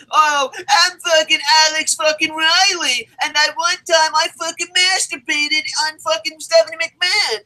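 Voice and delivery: high-pitched dopey wrestler impression; mocking imitation. Mocking a reality-show wrestler's words.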